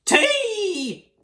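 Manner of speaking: high, pleased little dinosaur voice; delighted, climbing to a squealed last word; gleeful murmur. A narrator's reading. Little Comp thrilled with the stolen egg, high gleeful voice. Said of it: "and murmured".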